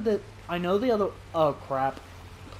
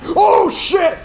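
sudden shout of real fright, much higher and louder; startled scream; 11 semitones higher, 16 dB louder than usual. Real, not acted. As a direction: jump out of your skin and yell. Jump scare from dinosaur.

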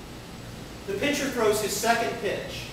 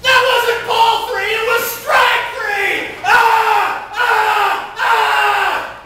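far higher, louder, slow furious yelling; enraged scream; 11 dB louder, much slower than usual. Retold or imitated. Rookie pitcher screaming at the umpire, enraged. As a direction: scream it out in a full tantrum. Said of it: "the kid is really losing it".

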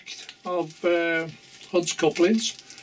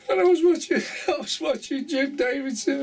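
higher pitch, still giggling; real laughter in the voice; 8 semitones higher than usual. Real, not acted. Moving on to a comedian's joke, still laughing. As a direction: start the next story before the laugh has settled.

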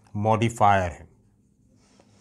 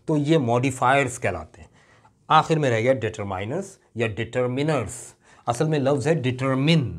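ordinary talk, nothing marked

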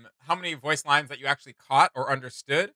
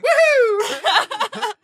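much higher, louder whoop through a laugh; laughing woohoo; 8 dB louder than usual. Acted. Hyping up the DJ bit for the co-host, laughing whoop. Finishing mock DJ performance.

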